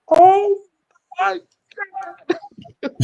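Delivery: very high, strained puppet-like impression; Paul Heyman. Manager hearing the favor, high Ernie-like imitated dismay. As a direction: squeeze out mock dismay in broken pieces. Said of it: "you sound like Ernie with Roman's or Paul Heyman's voice".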